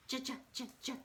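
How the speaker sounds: bouncy, repeated "ja, ja, ja"; staccato "ja" in quick hops; jumping J. Shown as a demonstration. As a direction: bounce short "ja" beats like jumping. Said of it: "He just likes to jump".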